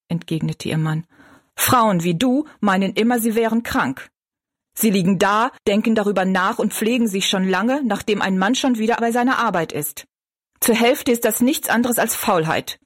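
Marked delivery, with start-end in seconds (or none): 1.6-12.8 grim reproach, pitched a little up. A narrator's reading. Husband accuses his sick wife of laziness, grim. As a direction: grumble a scornful accusation. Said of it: "sagte August grimmig".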